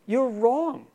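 emphatic rebuttal. Real, not acted. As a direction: punch "wrong" up and out. Contradicting the listener.

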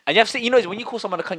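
ordinary talk

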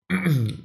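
clearing a choked throat; hard cough to clear the throat. Real, not acted. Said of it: "ich hab mich verschluckt".

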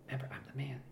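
walking away while talking; fading into distance. Pretend. Son leaving, fading out.

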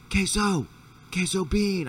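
high calling yell; retold call for the dog. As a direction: call the lost dog into the dark. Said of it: "Now I'm yelling".

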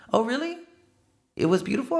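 high mock-sweet oh really; sarcastic, high and bright; sarcastic fake surprise. Confronting the relative who skipped his wedding, sarcastically high. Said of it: "I was like".

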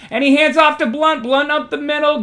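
lively sportscaster rush; excited play-by-play. Pretend. Live call of a run.

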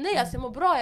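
loud, high-pitched reassurance; insistent retold protest. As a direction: brush off the teacher's concern emphatically. Retells her protest to teachers.

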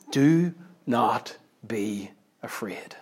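very slow, hushed, word by word; hushed solemn quote. Acted. Preacher quoting Jesus to a congregation, hushed and very slow. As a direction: let each word fall softly, with space between.

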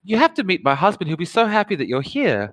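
high, sugary hostess voice; sweet 1950s housewife. Acted.

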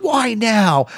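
high put-on whine; Dakota Kai. Podcaster imitates Dakota Kai reacting, high mock plea. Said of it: "that's not what Dakota Kai sounds like".

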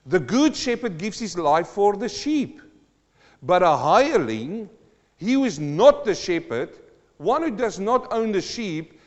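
loud, ringing pulpit delivery; preaching at full force. Acted. Preacher reading scripture aloud to the church, loud and ringing. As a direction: proclaim it to the congregation.

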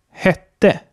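hätte with a short, open E as in Bett. Shown as a demonstration. Teacher gives Swedish example hätte, short open E. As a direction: say hätte as Swedish hette, first vowel short. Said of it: "das kurze E".

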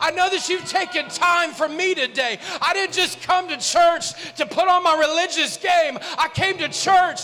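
high, loud impassioned preaching; preaching at full force. Real, not acted. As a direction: preach it full throttle to the church.

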